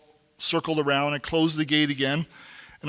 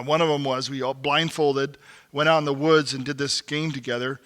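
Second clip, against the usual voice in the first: usual speaking voice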